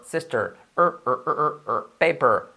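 normal talking voice

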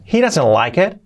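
he doesn't like it with a clear rising and falling melody; with intonation. Shown as a demonstration. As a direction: give the sentence its tune. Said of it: "le damos también entonación". (con entonación marcada)

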